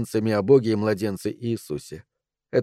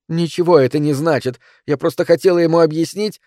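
raised, higher quarrelling voice; heated defence; 6 semitones higher, 5 dB louder than usual. A narrator's reading. Wife justifying herself to shouting husband, heated. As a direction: argue back forcefully.

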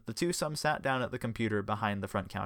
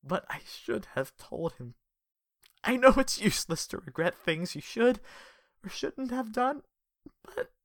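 shaky sad; 11 semitones higher than usual. Acted. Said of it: "He sighed shakily".

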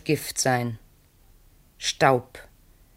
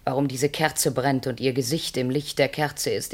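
ordinary talk

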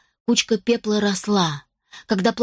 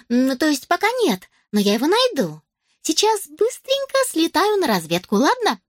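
put-on much higher elf girl voice; 8 semitones higher than usual. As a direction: chirp it like an eager elf.

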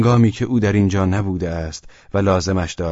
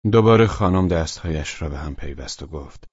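plain reading voice

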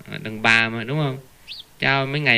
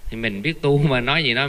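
ordinary talk, nothing marked

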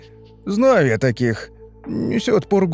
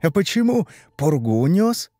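honeyed, higher-pitched question; sweetly needling; 7 semitones higher than usual. A narrator's reading. Brother-in-law catches Ivan out, sugary. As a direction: ask with mock sweetness. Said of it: "сладко спросил Владимир".

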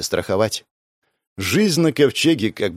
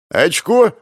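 high, loud happy cheer; joyful; 8 semitones higher, 6 dB louder than usual. Acted. Gosha celebrating the recruits, a high loud happy cry. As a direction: cheer like scoring a point. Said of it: "радостно произнёс Гоша".

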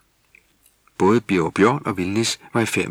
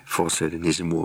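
ordinary delivery, nothing marked

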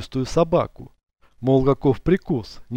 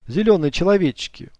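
joking; 6 semitones higher than usual. A narrator's reading. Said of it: "усмехнувшись, отшутился".

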